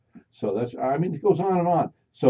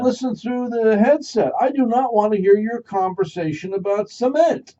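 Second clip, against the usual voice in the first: annoyed diner; 13 dB louder than usual. Retold or imitated. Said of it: "Shut your thing off".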